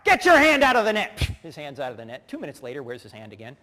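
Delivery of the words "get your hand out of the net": loud, high bossy shout; mother's retold yell. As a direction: imitate a commanding mom on the sideline. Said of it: "yells out, she's a D".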